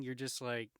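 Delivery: plain reading voice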